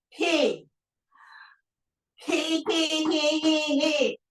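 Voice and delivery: high tittering he-he laughs; laughter yoga he. Shown as a demonstration. Instructor leading class in laughter yoga, high tittering he laughs. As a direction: giggle out he sounds up high. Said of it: "And then he".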